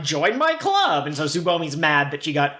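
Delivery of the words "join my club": bubbly, high and slow; pushy cheerful demand; excited high shout. Retold or imitated. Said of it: "Erika will just grab people and be like".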